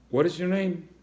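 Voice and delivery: high, loud stern question; a stern principal asks. Acted.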